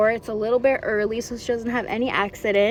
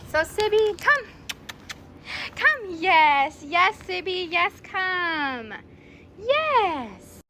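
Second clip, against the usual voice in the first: high, louder sing-song calling of the puppy; excited calling; 7 semitones higher, 4 dB louder, much slower than usual. Real, not acted. Trainer calling the puppy Sibby to come, high and excited. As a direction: call her over with eager excitement. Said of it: "call her over like this and say".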